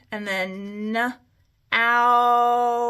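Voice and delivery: "n, ounce" split apart, ow stretched; sounded out. Shown as a demonstration. Teacher to class, sounding out "n, ounce" slowly. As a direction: say "n" then a drawn-out "ounce". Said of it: "So I'm gonna do uh".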